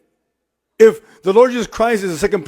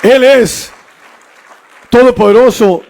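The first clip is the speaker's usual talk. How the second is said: emphatic proclamation; 4 semitones higher, 5 dB louder than usual. Real, not acted. Preaching climax.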